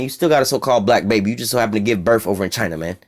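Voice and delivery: normal talking voice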